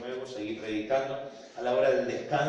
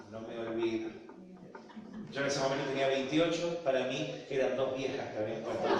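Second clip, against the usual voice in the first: ordinary delivery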